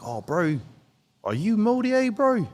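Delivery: high-pitched imitation of Māori Kiwi speech; New Zealand Māori accent. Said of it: "I learned how to speak like them".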